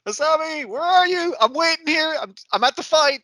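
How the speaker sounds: high, loud calling from the fight; robot calling over radio. Retold or imitated. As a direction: call out to him over the radio. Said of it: "hear a man going".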